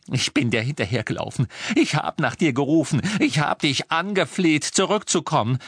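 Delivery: high, mounting reproach; bitter accusation. Acted. Hermine klagt Ron an.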